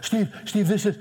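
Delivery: high-pitched, imitating a hysterical young woman; retold hysterical caller. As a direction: frantically blurt it out, mimicking a distraught caller. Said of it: "She's hysterical".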